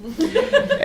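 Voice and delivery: quick high giggle; short real laugh. Real, not acted. Teller laughing at the hideous mascot, short real laugh. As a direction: let a laugh slip out.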